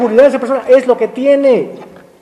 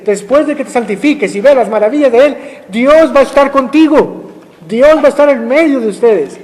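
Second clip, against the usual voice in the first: ordinary talk, nothing marked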